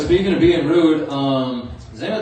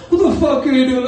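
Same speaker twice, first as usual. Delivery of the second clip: high, loud telling-off; acted angry boss; mock yelling; 10 semitones higher, 5 dB louder, faster than usual. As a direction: yell at a clumsy worker, half in fun. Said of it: "he gets yelled at".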